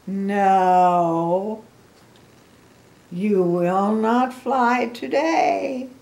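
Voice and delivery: loud, slow, emphatic refusal; Gerald. Acted. Gerald the elephant tells Piggy no, slow and emphatic. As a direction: lay down each word heavily and drawn out.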